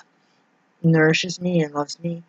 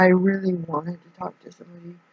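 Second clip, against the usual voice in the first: ordinary talk, nothing marked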